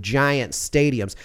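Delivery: normal talking voice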